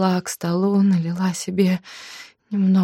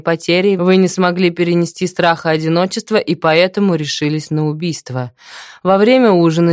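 loud, cold accusation; stern accusing inspector; 8 dB louder than usual. Acted. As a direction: lay out the charge firmly.

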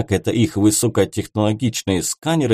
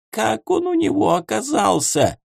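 much higher panicked question; panicked homunculus; 11 semitones higher than usual. A narrator's reading. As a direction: clutch your head in panic. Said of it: "Гомункул схватился за голову".

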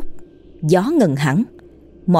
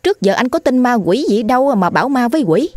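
higher, incredulous questioning; surprised; 6 semitones higher than usual. Acted. Wife questioning her frightened husband, higher and incredulous. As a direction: ask in startled disbelief. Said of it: "Cô Mận ngạc nhiên hỏi lại".

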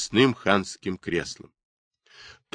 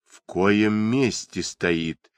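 ordinary delivery, nothing marked

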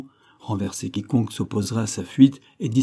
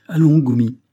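loud urging call; 4 semitones higher, 8 dB louder than usual. Acted. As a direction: call him to action, loud. Captain urging Goumi to act.

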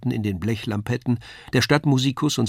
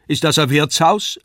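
Kasperl's voice; 4 semitones higher, 5 dB louder than usual. A narrator's reading. Kasperl asks Wagner.

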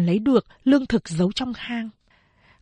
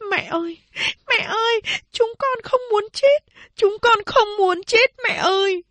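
very high sobbing plea; crying children begging; 13 semitones higher than usual. A narrator's reading. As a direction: wail and beg like terrified little girls. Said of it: "Các chị van xin mẹ". (các chị khóc van xin mẹ, giọng rất cao)

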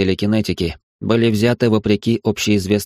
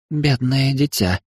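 slow, higher, puzzled pity; puzzled demoness; 5 semitones higher, slower than usual. Acted. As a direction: muse over the poor child. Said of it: "озадаченно произнесла демонесса".

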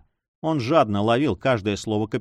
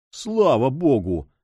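slow, grateful cry of relief; relieved exclaim; 2 semitones higher, slower than usual. A narrator's reading. Monk relieved the villain will live, slow grateful exclaim. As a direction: let the thanks to God ring out slowly. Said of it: "вскричал монах".

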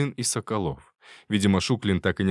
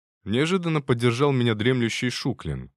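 usual speaking voice

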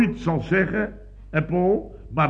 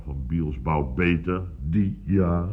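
ordinary talk

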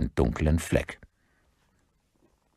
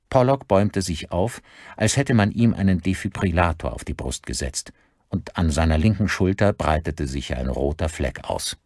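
ordinary delivery, nothing marked

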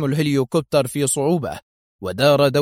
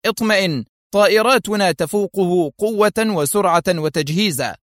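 firm; 6 semitones higher than usual. Acted. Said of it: "في حزم".

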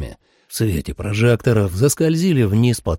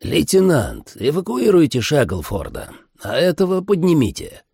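high, raised, authoritative voice; commanding order; 8 semitones higher than usual. A narrator's reading. Agent in charge directing his lieutenant, commanding. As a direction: issue orders to a subordinate. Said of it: "приказал знакомый голос".

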